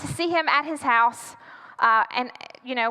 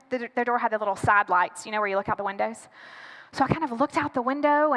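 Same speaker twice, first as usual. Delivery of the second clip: ordinary talk, nothing marked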